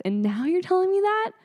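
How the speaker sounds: incredulous, voice shooting up high and loud; hammed-up indignant disbelief; mock outrage. Patient hearing the warning too late, mock outrage.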